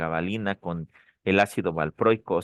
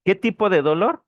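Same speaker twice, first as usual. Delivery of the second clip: quizzing question; 11 semitones higher, 6 dB louder than usual. Real, not acted. Teacher quizzes the class.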